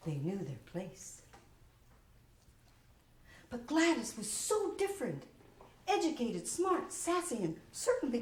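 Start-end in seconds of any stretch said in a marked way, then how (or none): none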